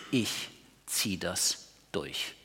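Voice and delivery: slow, low, soft determination; quietly grit it out; grim inner resolve. Retold or imitated. Sufferer telling himself to endure, slow and low. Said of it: "du spürst noch irgendwie".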